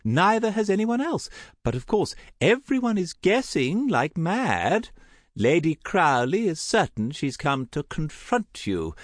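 high, swooping society lady; theatrical high-pitched gossip; effusive gossiping aunt. Acted. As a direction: gush with relish over every detail. Voices gossiping Aunt Tessa.

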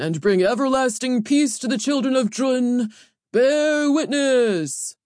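booming, high-pitched herald's proclamation; carrying across the arena. A narrator's reading. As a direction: announce the hero with grand pomp, stretching key words. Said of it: "his voice carrying across the arena".

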